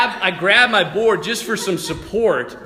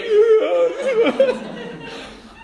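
laughing cry; 4 dB louder than usual. Retold or imitated. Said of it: "he's crying".

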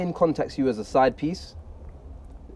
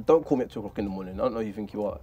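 cold dismissal; 9 semitones lower, 5 dB quieter than usual. Retold or imitated. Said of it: "the energy I gave him was".

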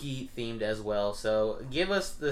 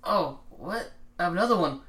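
ordinary delivery

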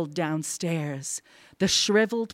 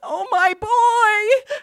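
panting desperation; 6 dB louder than usual. A narrator's reading. Wife pleads for her dead son, desperate and tearful. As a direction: urge frantically, then wail in grief. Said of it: "she panted".

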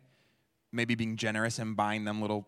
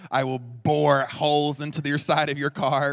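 louder, mock-menacing threat; mock road rage; 5 semitones higher, 9 dB louder than usual. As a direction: glare it out like a fuming driver. Angry driver glaring at traffic.

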